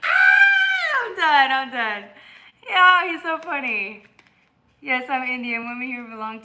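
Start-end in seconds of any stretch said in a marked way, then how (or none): 0.0-2.0 high shriek, then giving up; can't stop laughing; breathless giggle. Real, not acted. Overcome by laughter. 2.7-4.0 laughing. Real, not acted. Still laughing at chat.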